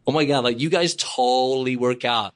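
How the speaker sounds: put-on slow, drawn-out valley-girl gushing; lightly ape an impressed American; mock gushing fan. Mocking girls in Vegas by the pool, drawn-out gushing.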